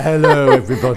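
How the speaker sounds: mock film-star hello, laughing; hammed-up glamorous greeting; imitating glamorous TV voice. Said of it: "very Gina Lollobrigida".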